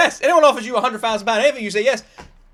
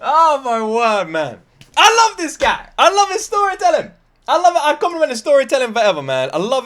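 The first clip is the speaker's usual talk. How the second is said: gushing praise; 2 semitones higher, 5 dB louder than usual. Real, not acted. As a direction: rave about the storyteller. Reactor gushing about comedian.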